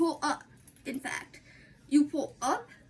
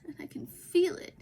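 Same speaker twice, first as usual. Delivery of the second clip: ordinary talk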